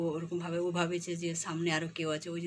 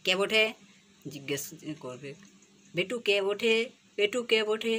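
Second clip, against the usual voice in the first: imitating bird's chatter; 4 semitones higher, 7 dB louder, slower than usual. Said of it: "এত স্পিডে দৌড়বে আর বলবে".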